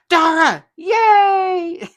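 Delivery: high, loud, laughing yay for Dara; squealing with delight as she reads it out; excited cheer. Retold or imitated. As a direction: cheer her name like a fan. Reading a fan's cheering comment.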